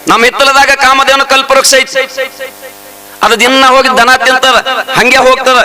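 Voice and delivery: high, ringing sermon voice; fervent village preacher; preaching at full force. Acted. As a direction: preach it out to the crowd.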